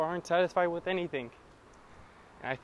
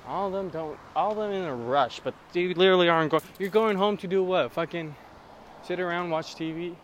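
louder, fed-up rant at drivers; angry rant; 2 semitones higher, 7 dB louder than usual. Real, not acted. Ranting about Los Angeles drivers, louder and angry. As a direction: vent at the drivers, loud.

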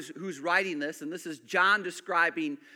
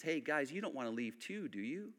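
soft Jesus; 6 semitones lower, 10 dB quieter than usual. Retold or imitated. Jesus repeats question softly.

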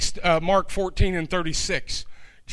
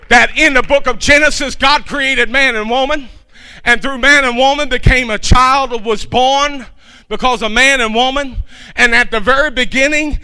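loud, higher, building sermon cry; fiery pulpit climax; full-force preaching; 4 semitones higher, 7 dB louder than usual. Acted. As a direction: preach it out, rising to a shout.

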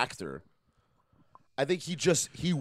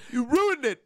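mock outburst; 11 semitones higher, 5 dB louder, slower than usual. Echoes the mock blame.

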